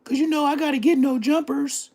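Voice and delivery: high put-on voice mocking him; mocking his excuse in a high put-on voice; mock inner voice. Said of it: "You did like everybody else".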